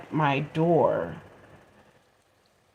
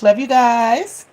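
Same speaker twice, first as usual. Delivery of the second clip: love you guys; 5 semitones higher, 7 dB louder, slower than usual. Real, not acted. Shout out to sisters.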